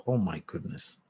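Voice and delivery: ordinary delivery, nothing marked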